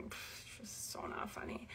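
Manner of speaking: flat whispered put-down; unimpressed sister. Retold or imitated. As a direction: scoff it off like a bored sister. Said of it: "my sister looks at me and she's like".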